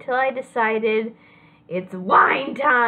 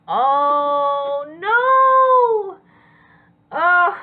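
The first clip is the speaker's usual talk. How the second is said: dismayed cry; 7 semitones higher, 6 dB louder, much slower than usual. Real, not acted. Reacting to ruined pen.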